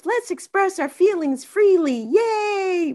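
high, bright-eyed parody of openness; mock gushing cheer. Speaker mocks England's imagined cheer, high gushing parody. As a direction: squeal it like a cheerful feelings advert. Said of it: "not the land of".

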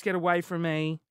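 ordinary talk

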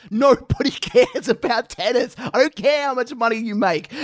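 mock rant. Real, not acted. Ranting to his co-host about tennis for laughs. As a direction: rail against tennis for laughs.

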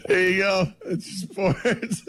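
breathless laughing words; choking with laughter; words squeezed through giggles. Real, not acted. Cracking up after the joke.

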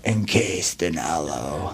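half-joking dark metal growl, drawn out. Pretend.

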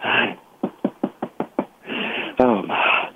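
panting after walking up; winded, breathy words between gasps; out of breath. Real, not acted. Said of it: "I am out of breath".

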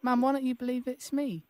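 high, quiet put-on child voice; little boy Billy. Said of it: "Billy? Bill-, Billy".

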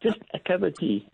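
high, marvelling "a cup of tea"; amazed realization. Retold or imitated. Telling the host about the boss, amazed at the tea. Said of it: "I thought".

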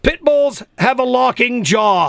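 slow, deliberate quiz-host delivery; contest announcer. Real, not acted. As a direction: stretch out the question and phone number for listeners.

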